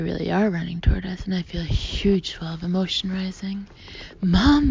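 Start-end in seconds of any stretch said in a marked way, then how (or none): 4.2-4.7 high, loud emotional shout. A narrator's reading. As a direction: shout it running toward her. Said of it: "I shout".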